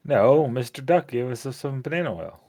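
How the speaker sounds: ordinary talk, nothing marked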